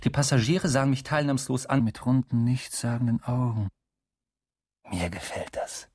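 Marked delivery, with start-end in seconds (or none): 4.9-5.9 soft aside. Acted. Narrator's proud aside.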